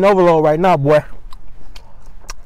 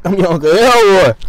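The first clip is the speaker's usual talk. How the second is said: louder, put-on uncle voice; hammed-up Uncle Elroy; 2 semitones higher, 7 dB louder than usual. Introducing himself to viewers as Uncle Elroy, hammed up. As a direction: announce yourself as the goofy uncle.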